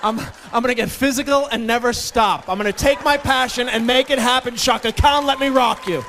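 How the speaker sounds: loud, high, pumped-up eighties cheer; pumped eighties hype. Retold or imitated. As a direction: belt it like an aerobics instructor. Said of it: "in nineteen eighty-four I said".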